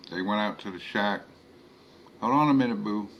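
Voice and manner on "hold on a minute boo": Creole woman. A narrator's reading. Philomene voiced by narrator.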